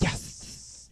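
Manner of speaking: YES whispered into a long hissing S; breath-only YES, S held long; imitated hissed yes. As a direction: hiss out the end of YES. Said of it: "He did like a yes".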